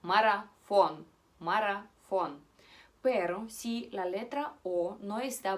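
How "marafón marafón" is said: marafón punched loud on a full final O; stressed final O. Shown as a demonstration. Teacher repeats the correct Russian word, stressed final O. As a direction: hit the O at the end of marafón. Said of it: "bajo el acento".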